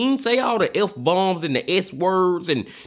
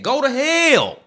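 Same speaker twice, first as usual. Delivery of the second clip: angry curse; 8 semitones higher, slower than usual. Real, not acted. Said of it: "I'm getting a little upset and emotional now".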